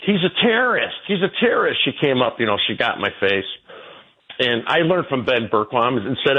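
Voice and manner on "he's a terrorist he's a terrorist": high, shrieking mock of an accuser; mocking the woman. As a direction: imitate her pointing and yelling high. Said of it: "this beast saw me".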